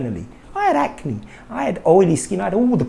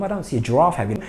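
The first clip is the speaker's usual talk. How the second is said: normal talking voice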